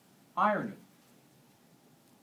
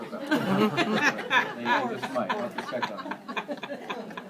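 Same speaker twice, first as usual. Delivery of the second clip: chuckling, slow, higher; laughing aside; 6 semitones higher, slower than usual. Real, not acted. Preacher joking to congregation about goat meat, laughing. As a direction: laugh through the joke.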